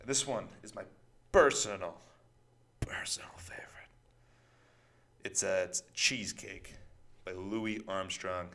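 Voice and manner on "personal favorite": breathy whispered aside. Real, not acted. Whispering his favorite song to the audience. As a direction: whisper it like a secret. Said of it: "unvoiced whisper".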